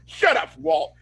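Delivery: high, abrupt shout-down; hammed-up dismissive outburst; mock angry yell. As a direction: cut someone off in retold exasperation. Retold group interrupting Walt.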